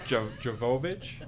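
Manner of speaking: "Jovovich" tried haltingly with a questioning rise; unsure pronunciation. Real, not acted. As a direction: stumble into "Jo-, Jovovich" unsure of the name. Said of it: "I hope I say that".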